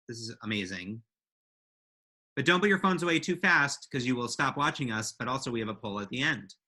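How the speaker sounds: usual speaking voice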